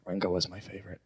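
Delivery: hushed confiding secret; leaning in to whisper. Acted. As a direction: drop to a secret hush. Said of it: "Can I tell you a secret?".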